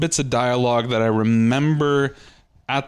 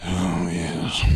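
low, softer put-on "oh yes"; mock posh agreement; 6 semitones lower, 4 dB quieter than usual. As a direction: agree grandly in the joke voice. Teasing friend readjusting on couch.

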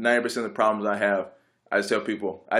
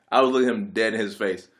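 ordinary talk

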